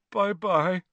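high, forlorn farewell; mournful ghost-girl goodbye; gloomy. A narrator's reading. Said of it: "she said gloomily".

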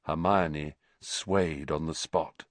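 plain reading voice

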